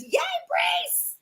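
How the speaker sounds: very high squeaky cheer; playful falsetto hurrah, drawn out; put-on cheering voice. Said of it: "Not in that voice, though. That's not Barry's voice".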